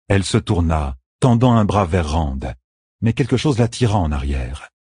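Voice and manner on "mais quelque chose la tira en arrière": ordinary delivery, nothing marked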